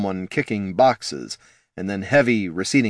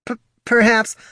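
high, stuttering perhaps; nervous stutter; 13 semitones higher, 4 dB louder than usual. Acted. Poldo stuttering in fear.

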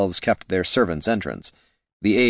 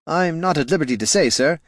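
polite aide; 7 semitones higher than usual. Acted. The aide answers Gorm.

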